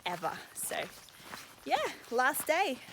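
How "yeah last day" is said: mild cheer. Real, not acted. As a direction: say it brightly, at talking volume. Cheering the final day, first take.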